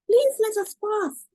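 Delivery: high, pleading voice; Louis begging the bandit on the path, high pleading. Acted. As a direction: beg to be let through, pitched high.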